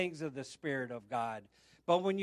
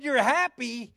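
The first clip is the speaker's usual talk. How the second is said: high, loud questioning echo; retold astonished question, pitched up; acted surprise; 7 semitones higher, 7 dB louder than usual. Acting his surprised reply to her.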